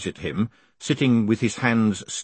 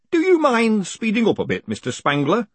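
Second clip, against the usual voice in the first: high, louder hangman voice; impatient hangman; 12 semitones higher, 4 dB louder than usual. Acted. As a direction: hurry the prisoner along, politely. Hangman hurries the condemned man.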